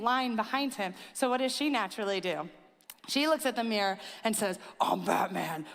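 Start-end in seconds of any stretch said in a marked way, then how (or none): 4.8-5.7 Batman voice. Retold or imitated. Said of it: "in the voice".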